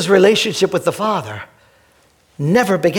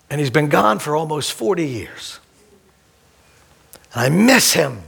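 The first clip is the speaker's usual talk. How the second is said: tender memory; 6 semitones lower than usual. Real, not acted. Pastor to congregation about his family, tender. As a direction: speak gently of family.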